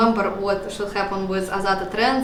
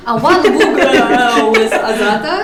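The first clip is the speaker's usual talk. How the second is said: laughing through the words; giggling while admitting it; 9 dB louder, slower than usual. Real, not acted. Laughs through an embarrassing bug.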